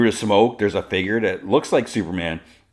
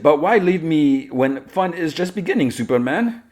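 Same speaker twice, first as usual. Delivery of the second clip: high, loud, teasing villainess voice; pleading villainess; 10 semitones higher, 4 dB louder than usual. Retold or imitated. Tied-up villainess to departing Superman, coaxing. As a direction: coax him back, hammed up.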